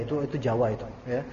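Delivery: ordinary talk, nothing marked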